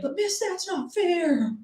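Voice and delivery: student voice. Retold or imitated. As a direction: mimic a tattletale schoolgirl. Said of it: "was like".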